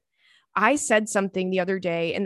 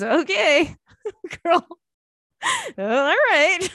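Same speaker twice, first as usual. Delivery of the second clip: man's amused reply; 9 semitones higher, 4 dB louder, much slower than usual. Retold or imitated. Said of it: "He goes".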